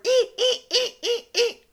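very high, loud repeated squeaks; piercing little rhythmic "ee" yelps; mock cranky-bottom squeaking. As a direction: imitate a squeaky falsetto moan. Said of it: "cranky bottom who's like".

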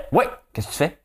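high, nagging phone voice; nagging caller. Pretend. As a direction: pester the other parent on the phone.

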